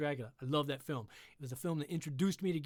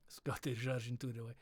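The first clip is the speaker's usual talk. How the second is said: Scottish Russian accent; 4 semitones lower, 6 dB quieter than usual. Shown as a demonstration.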